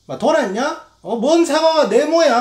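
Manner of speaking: rising to a high, loud jeer; hammed-up scornful yell; mocking outrage. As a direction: ridicule the drawing, building up. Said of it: "하면서 막".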